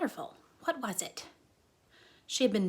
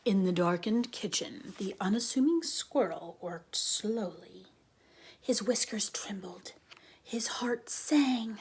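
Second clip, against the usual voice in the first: hushed narration. A narrator's reading. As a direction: draw listeners in with a hush. Hushed story opening.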